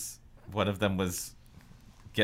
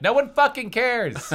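high, loud fed-up outburst; exasperated yell; 13 semitones higher, 5 dB louder, slower than usual. Retold or imitated. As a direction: blurt it out, fed up. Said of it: "I'm like".

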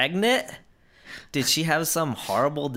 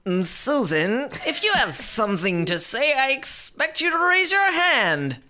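stern teacher; 11 semitones higher, 3 dB louder than usual. Pretend.

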